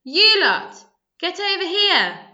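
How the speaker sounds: put-on a boy calling his gang from the driveway, shouting. A narrator's reading. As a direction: holler across the street to your mates. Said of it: "he shouted".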